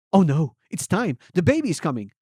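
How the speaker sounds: a touch of panic as labour starts; mild alarm. Acted. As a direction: call it out, lightly panicked. Water breaks, birth begins.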